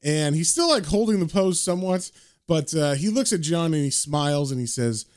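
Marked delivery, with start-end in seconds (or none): none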